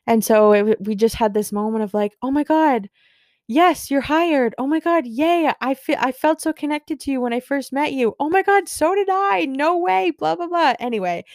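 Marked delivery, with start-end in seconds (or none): 2.2-10.7 much higher, squealing excited imitation; gushing squeals. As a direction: replay the mutual gushing as giddy squeals. Both gushing at the hiring.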